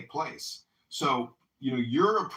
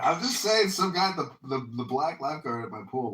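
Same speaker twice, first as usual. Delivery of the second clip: normal talking voice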